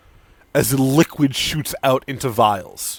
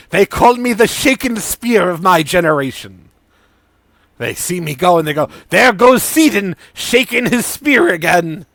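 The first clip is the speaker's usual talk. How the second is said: higher, louder bragging; boastful Sidon; 7 semitones higher, 8 dB louder than usual. Acted. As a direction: brag like a vain showman.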